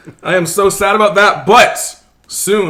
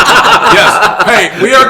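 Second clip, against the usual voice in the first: laughing; 5 semitones higher, 8 dB louder, much slower than usual. Real, not acted. Laughing at joke.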